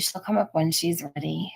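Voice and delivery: soft whispered aside; hushed reassurance about the nervous guest. Real, not acted. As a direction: whisper it gently so only she hears.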